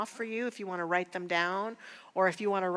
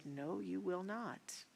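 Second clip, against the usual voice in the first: quiet firm no; 10 dB quieter, slower than usual. Retold or imitated. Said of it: "she looked him straight in the eye and she said".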